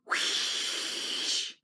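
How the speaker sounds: hissing rush of steam. A narrator's reading. James letting off steam on the crowd, long hiss.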